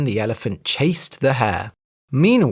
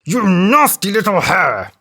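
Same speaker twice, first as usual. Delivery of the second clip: a raised, angry elephant cry; elephant cries out; 4 semitones higher, 4 dB louder than usual. A narrator's reading. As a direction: shout at the hare in fury. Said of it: "cried the elephant".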